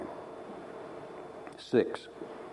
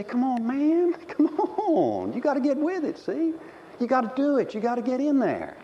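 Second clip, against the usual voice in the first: higher, slangy coaxing; smooth-talking the girls; teen boys pressuring; 11 semitones higher than usual. Retold or imitated. Preacher imitating boys on TV, higher. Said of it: "And they say".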